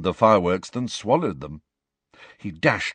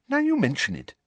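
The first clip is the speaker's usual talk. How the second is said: happy; faster than usual. A narrator's reading. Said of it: "said Hermione happily".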